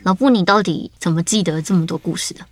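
usual speaking voice

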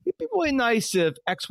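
husband mimics his wife's sweet hint; imitating wife. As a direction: play the wife hinting from the couch. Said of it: "she would be sitting on the couch and go".

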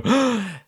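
sharp high gasp of wonder; villagers gasp. Acted. Villagers see the little torch light, a sharp amazed gasp. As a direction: suck in air at the sight. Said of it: "gasp, and they go".

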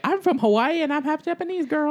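high, sassy put-on voice; Carrie Ann impression. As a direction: sass it up in a high voice. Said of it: "She was like".

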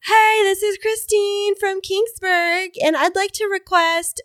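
put-on high, slow kid caller voice; kid radio caller. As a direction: play a shy kid calling the radio. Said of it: "just to be like".

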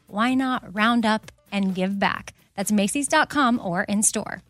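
upbeat sponsor read, quick and bright; host reading a Macy's sponsor spot. Acted. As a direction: sell it like a podcast ad.

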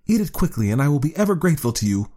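high, rushed pleading; urgent pleading. A narrator's reading. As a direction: hurry the words out, pleading. Woman urging cat.

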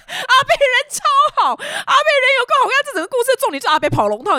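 talking through high-pitched laughter; laughing. Real, not acted. Storyteller amused by the kind neighbour, real laughing speech. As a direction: crack up while telling it, voice shooting up. Cracks up at her own story.

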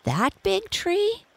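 high-pitched, drawn-out disbelief; astonished cry. A narrator's reading. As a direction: cry out in rising amazement. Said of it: "cried Toto".

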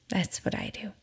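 tiny whispered aside; whispering. Real, not acted. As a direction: whisper it to yourself. Aside during counting exercise.

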